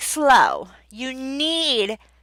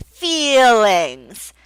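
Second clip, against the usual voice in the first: louder, stretched feelings; clear model word; 5 dB louder than usual. Shown as a demonstration. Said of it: "have them repeat it".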